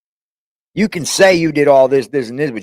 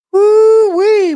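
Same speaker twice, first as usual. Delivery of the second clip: high, loud whooping cheer; excited whoop; 6 dB louder than usual. Acted. Streamer cheers as Bob appears, loud whoop. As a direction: whoop it out like a hype man. Hyping up the cat's arrival.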